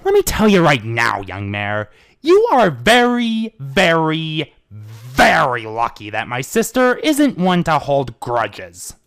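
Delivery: put-on older brother lecturing the mare; stern warning. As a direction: lean on each very, slowly. Stern brother scolds Trixie.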